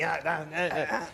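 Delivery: imitating his wife's wordless nagging; mocking wife. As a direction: mimic her mocking in short repeated noises. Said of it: "You see how she mocks me?".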